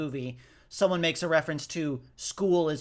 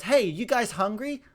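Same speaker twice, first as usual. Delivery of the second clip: quoting Pete; 6 semitones higher than usual. Retold or imitated. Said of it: "And then he goes".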